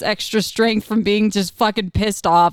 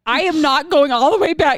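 higher-pitched retold protest; adamant refusal; 7 semitones higher than usual. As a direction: mock-desperate digging in of heels. Retold standoff at front desk.

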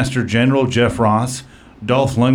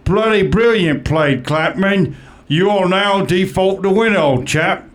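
higher, mock posh British accent; Simon Cowell; 7 semitones higher than usual. Talent judge declaring Klapman the winner in a mock British accent. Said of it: "Simon Cowell".

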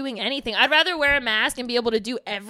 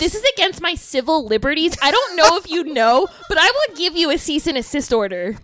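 loud, higher-pitched Karen rant; outraged Karen; 3 semitones higher, 5 dB louder than usual. Acted. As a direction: protest like an entitled Karen, raised voice. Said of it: "we could be Karens".

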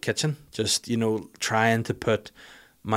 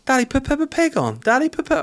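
put-on much higher child's pleading voice; three-year-old's voice; 12 semitones higher than usual. Daughter begging daddy for Peppa Pig, high child voice. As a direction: whine like a toddler wanting Peppa. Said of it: "the three-year-old's like".

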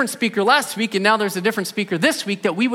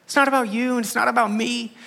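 said in love; 4 semitones higher, 4 dB quieter than usual. Retold or imitated. Pastor to a new staff member, gently slowed and soft. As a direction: plead gently, slowed right down. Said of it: "I said in love".

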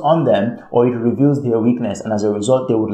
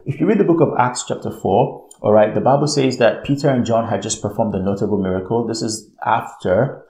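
ordinary talk, nothing marked

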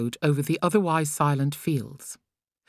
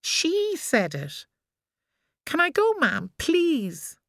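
put-on higher pleading little boy; child's voice; 13 semitones higher, slower than usual. A narrator's reading. Boy begging his mother to let him play, pleading. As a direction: whine to be let go.

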